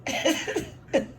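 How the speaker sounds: put-on a twelve-year-old shocked by new sounds; high, amazed kid. Kid marveling at Boston accent.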